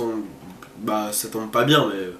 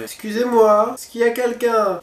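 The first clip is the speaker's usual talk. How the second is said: high, loud polite call; 9 semitones higher, 5 dB louder than usual. Real, not acted. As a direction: call into the empty room. Calling out in dark house.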